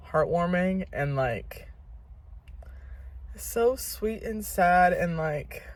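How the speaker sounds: usual speaking voice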